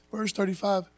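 ordinary talk

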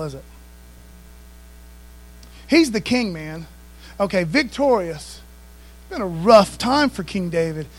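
ordinary delivery

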